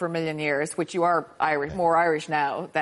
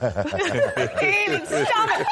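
high-pitched, laughing outcry; laughing protest; 12 semitones higher than usual. Real, not acted. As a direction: protest through real laughter. Teasing co-host over shower habits.

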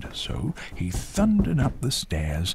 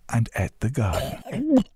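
ordinary delivery

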